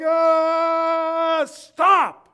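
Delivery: high, loud exasperated yell; frantic shout. Retold or imitated. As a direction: yell it like you're overwhelmed. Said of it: "we're like".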